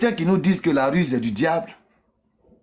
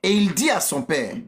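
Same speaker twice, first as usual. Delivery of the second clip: usual speaking voice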